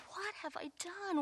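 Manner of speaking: soft, high, stunned whisper-like murmur; half to yourself, barely audible; dismayed hush. Retold or imitated. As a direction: breathe it out in quiet disbelief. Retelling her own dismayed thought.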